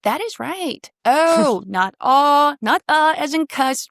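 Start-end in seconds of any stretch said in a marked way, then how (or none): 1.0-1.6 oh said big, round and emphatic; emotional oh. Shown as a demonstration. Coach showing the Shakespeare oh vowel with emotion. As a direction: give the oh its full emotion. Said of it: "my favorite vowel in the world".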